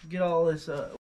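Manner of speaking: usual speaking voice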